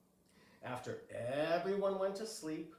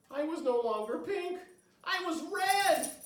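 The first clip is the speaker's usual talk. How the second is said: rising higher and louder into alarm; shocked discovery; 12 semitones higher, 8 dB louder than usual. Acted. Girl at the mirror realizing she has turned red. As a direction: build to a horrified realization.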